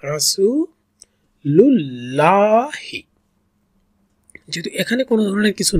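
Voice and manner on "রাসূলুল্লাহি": রাসূলুল্লাহি very slow with the সূ drawn by one alif; slow Quranic recitation of রাসূলুল্লাহি; one alif stretch. Shown as a demonstration. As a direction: stretch the সূ in রাসূলুল্লাহি, then glide on. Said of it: "রাসু এক আলিফ টানতে হবে".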